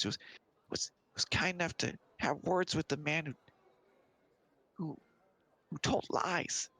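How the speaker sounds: lightly voiced child, high and halting; high-pitched child's plea; little kid. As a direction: explain it haltingly like a small kid. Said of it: "And the little kid goes".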